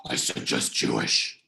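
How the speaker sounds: very low, slow growled comeback; defensive. Acted. Shutting down a pushy telemarketer, defensive. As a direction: dig in with a deep, slow growl. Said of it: "sounds like a defensive answer".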